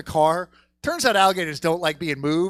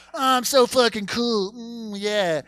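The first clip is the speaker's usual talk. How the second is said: smug hipster; 6 semitones higher, slower than usual. Retold or imitated. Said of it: "just fucking hip and fucking".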